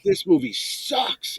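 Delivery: high, slow, drawn-out complaint; high-pitched retold griping; emphatic disgust. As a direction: drag out the verdict in mock agony. Retold verdict on hated film.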